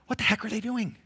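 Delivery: high-pitched exasperated question; acted impatience. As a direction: squeeze it out high, fed up. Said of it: "When you're getting impatient".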